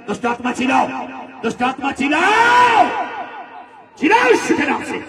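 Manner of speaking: high, loud shouted command at the demon. Real, not acted. Preacher shouting at the demon to scream. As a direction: bellow the order to scream out.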